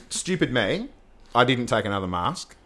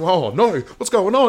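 retold panic; 7 semitones higher, 6 dB louder, slower than usual. Fighting octopus, retold panic.

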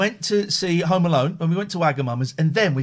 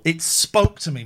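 ordinary delivery

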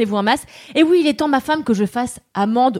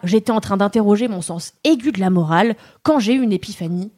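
ordinary delivery

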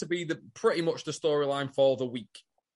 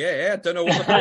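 laughing retelling; 3 semitones higher, 6 dB louder, much faster than usual. Retold or imitated. Toadie telling Mel, laughing.

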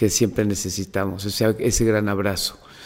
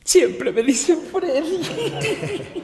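drawn-out laughing complaint, pitched way up; amused groan through laughter; much slower than usual. Real, not acted. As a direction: laugh the name out slowly. Laughing about being called Freddy.

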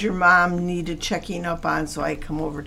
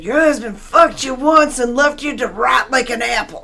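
loud, high mock tirade; retold yelling; 5 semitones higher, 7 dB louder than usual. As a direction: hurl the insult in mock fury. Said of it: "she's yelling at her".

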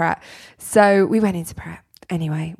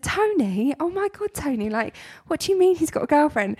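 higher, wailing disbelief; shocked; 8 semitones higher than usual. Retold or imitated. Retelling her shocked reply to Tony. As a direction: squeak it in disbelief. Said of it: "And I said".

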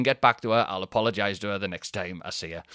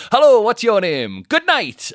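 loud, much higher hammed-up bellow; mock shouted host; 11 semitones higher, 11 dB louder than usual. Comedian parodying his act with a loud high bellow. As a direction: belt it out like a panto compere. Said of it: "you know I don't go on and say".

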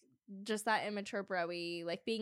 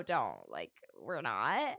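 imitating Tom; 11 semitones lower, slower than usual. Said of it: "and Tom's like".

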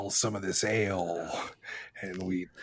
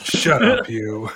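laughing shout; 12 dB louder than usual. Acted. Player snaps at the captive, laughing.

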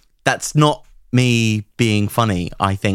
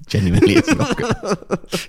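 laughing; 14 semitones higher than usual. Real, not acted. Insisting with a laugh.

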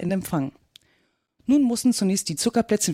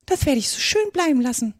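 higher-pitched, offended refusal; huffy mouse; 7 semitones higher than usual. Acted. Mouse refuses Alice as it leaves, huffy. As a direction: refuse in an indignant little voice.

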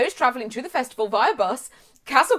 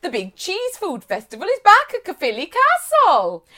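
put-on Welsh accent; 7 semitones higher, 6 dB louder than usual. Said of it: "I can't do a Welsh accent".

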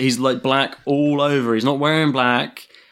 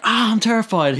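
hammed-up, higher-pitched fright; over-the-top terror; 8 semitones higher than usual. Showing co-host how the caller didn't sound, mock terrified. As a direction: fake terror like a bad actor. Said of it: "he's not so over the top".

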